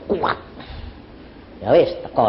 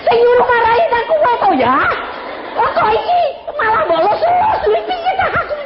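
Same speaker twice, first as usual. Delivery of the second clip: mock indignant woman. Woman complains, voiced by pastor.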